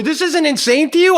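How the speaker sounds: high, loud appeal to a bystander; outraged yell. Retold or imitated. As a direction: yell it in sheer disbelief. Still screaming, turns to bystander.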